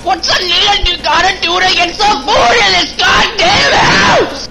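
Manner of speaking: high, loud frustrated shout; yelling. Acted. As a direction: bellow it out, fed up and hungry. Yelling about food in house.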